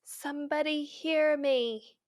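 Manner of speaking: slow, drawn-out theatrical cry for help; mock damsel plea. Woman locked in a jail cell calling out, mock theatrical. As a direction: imitate an old-fashioned stagey plea. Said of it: "Are you in the sixteen hundreds?".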